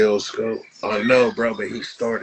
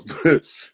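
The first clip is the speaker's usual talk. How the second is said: burst of laughter; 8 semitones higher, 9 dB louder than usual. Real, not acted. Laughs at his own rant.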